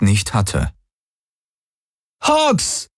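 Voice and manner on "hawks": hoher Ruf in die Ferne; laut nach jemandem rufen, ohne echte Lautstärke. A narrator's reading. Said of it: "rief sie". (hoch gerufener Name)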